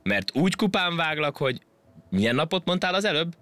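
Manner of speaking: high, boastful monkey voice threatening; bossy monkey. Acted. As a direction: puff up and threaten from above. Majom fenyegeti a hangyát. (hencegő, magas majomhang fenyegetőzve)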